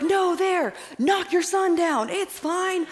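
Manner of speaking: high, loud, slow playful yelling; excited shout. Retold or imitated. Urging mom over FaceTime to grab eggs, joking shout. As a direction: holler it with gleeful mischief. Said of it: "and I'm like".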